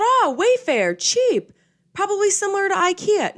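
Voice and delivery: naive mock voice. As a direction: squeal it like a gullible shopper. Mocking her naive past thought.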